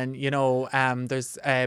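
ordinary delivery

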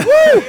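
very high, loud retold whoop; celebratory whoop. Bandmates drinking beers on Skype, whooping. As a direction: let out a piercing cheer.

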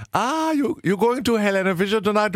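playing his own over-keen self; chirpy tourist voice. Chatting up a German shop clerk, eager and high. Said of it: "någon expedit och bara".

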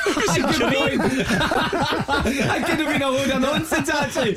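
high, slow laughing admission. Real, not acted. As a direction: laugh at yourself, caught out. Admits he can't verify Korean.

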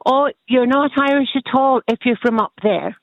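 normal talking voice

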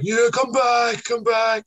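high mock crowd shout; imitating audience calling. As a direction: call the performer back like a whole crowd, voice way up. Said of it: "all the audience were like".

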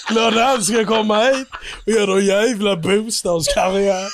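broad, hammed-up Scanian rant; skånska. Mocking the fat radio man, in broad skånska. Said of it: "vad heter det, skånska?".

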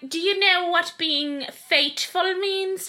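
high, very slow royal voice; stretched-out monarch impression; the Queen. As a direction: question pointedly in a put-on regal voice. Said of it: "I'm really good at doing the Queen".

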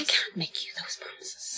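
soft unvoiced aside; whisper. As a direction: breathe it like a secret. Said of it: "echoes whisper".

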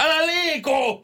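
very high, drawn-out mock shout; retold doctor's shout. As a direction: yell it like a startling command to a patient. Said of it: "Sit se huutaa mulle". (korkea, venytetty huuto)

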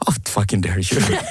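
low, slow mock-offended scold at the pet; playful fake indignation, drawn out; mock outrage. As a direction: pretend to be deeply insulted by a staring animal.

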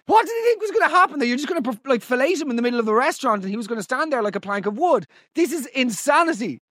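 high, fast comic rant; incredulous outrage. Real, not acted. Host disbelieving a caller's date story, incredulous.

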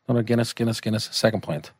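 usual speaking voice

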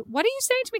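high, incredulous question; genuinely confused by your child; high bewildered mother asking; baffled mom. Retold or imitated. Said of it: "I said".

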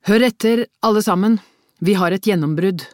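loud, high announcement to the room; calling for attention. A narrator's reading. Detective calls the whole team to attention. (roper forsamlingen til oppmerksomhet)

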